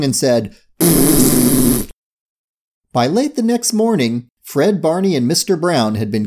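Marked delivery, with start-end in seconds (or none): 0.8-1.9 long buzzing tongue raspberry. A narrator's reading. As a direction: blow a triumphant raspberry with tongue out. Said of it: "stuck out her tongue".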